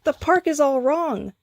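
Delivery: high, alarmed outcry; exclaimed. A narrator's reading. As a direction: blurt it out in shock. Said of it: "Skips exclaimed".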